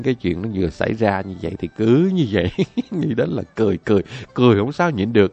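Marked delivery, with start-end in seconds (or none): none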